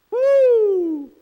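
high loud preacher whoop. Real, not acted. Preacher whooping to the congregation, loud and high. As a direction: let out a joyful whoop.

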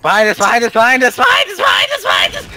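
building to a high, loud shout; alarmed warning shout. Real, not acted. Warning teammates of enemy.

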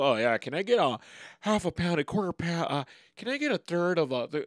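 lightly voiced polite deli customer. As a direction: put on a light, timid customer voice.

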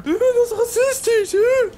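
laughing squeak. Real, not acted. Joking about car colour.

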